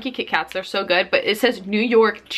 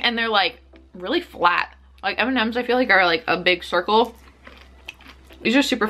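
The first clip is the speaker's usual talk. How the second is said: talking between bites; chewing candy while talking. Real, not acted. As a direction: mouth partly full. Seen 2: Puts candy in mouth at -2s, chews while talking afterward.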